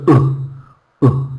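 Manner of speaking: short isolated "uh" grunts; the neutral schwa "uh" demonstrated alone; schwa sound. Shown as a demonstration. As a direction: say "uh" as a bare weak vowel. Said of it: "weak sound of English".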